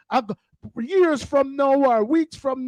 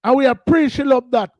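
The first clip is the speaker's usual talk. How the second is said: loud declaration; 7 dB louder than usual. Real, not acted.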